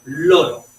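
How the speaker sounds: loud Loro with a clear r; misspoken word. Shown as a demonstration. Therapist repeats the child's Loro loudly for students. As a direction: punch Loro, r not d. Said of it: "Con L, con R".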